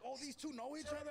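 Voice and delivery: high mock surprise, crowd voice; surprised crowd. Crowd amazed two rappers know each other, high surprised.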